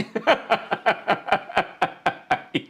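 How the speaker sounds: long wheezing laugh; helpless laugh. Real, not acted. Streamer losing it over a fart clip, wheezing laugh. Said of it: "laughing so hard I'm crying".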